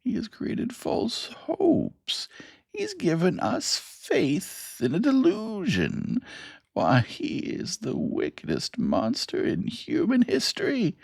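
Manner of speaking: put-on an old bearded man crying out in outrage. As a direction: accuse him in a cracked old voice. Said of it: "the old man with the beard cried".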